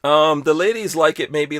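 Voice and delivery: ordinary delivery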